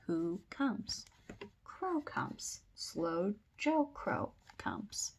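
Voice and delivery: slow, separated words for a learner; slow sight-word reading. Shown as a demonstration. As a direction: point out each word slowly. Repeats sight words slowly for children.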